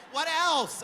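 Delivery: high-pitched, insistent questioning; demanding mom imitation. Mother interrogating son on phone.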